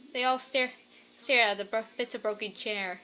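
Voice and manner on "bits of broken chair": usual speaking voice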